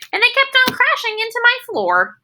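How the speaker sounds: high, squeaky complaint; exasperated squeal. Acted. Junie B complains about her juggling, high and squeaky. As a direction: let it jump way up in frustration. Lemons crashing, Junie B upset.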